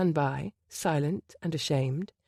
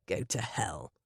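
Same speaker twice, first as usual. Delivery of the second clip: low, soft snarled curse; snarling; 10 semitones lower, 8 dB quieter, slower than usual. A narrator's reading. Gabriel to Lambert, a low soft snarl. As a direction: snarl it low under the breath. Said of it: "Gabriel snarled".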